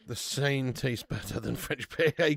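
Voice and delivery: usual speaking voice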